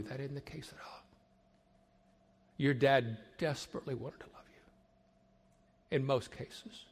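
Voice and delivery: soft whisper, slowing on the reassurance; a tender, sincere whisper; hushed whisper. Real, not acted. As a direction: lean in and whisper it gently. Tender reassurance to hurt children.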